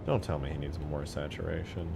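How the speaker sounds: ordinary talk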